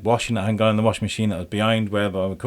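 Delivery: ordinary delivery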